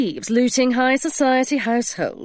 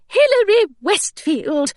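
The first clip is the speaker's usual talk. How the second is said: high, louder governess call of a name; startled governess catching her charge, calls out; cried out; 11 semitones higher, 4 dB louder than usual. Acted. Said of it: "Miss Grayson cried".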